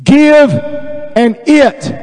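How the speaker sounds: very slow, high, drawn-out words; real slow reading. Shown as a demonstration. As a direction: stretch each word for emphasis. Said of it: "read it to you real slow".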